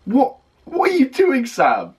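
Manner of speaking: stunned, high-pitched cries of disbelief; shocked disbelief. Real, not acted. Shocked by a vocal turn.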